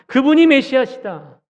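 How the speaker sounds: high, loud, fearless acted preaching; bold proclamation. Peter preaching to those who crucified Jesus, bold proclamation. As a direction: declare it boldly to hostile hearers. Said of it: "담대하게 전하는 거예요".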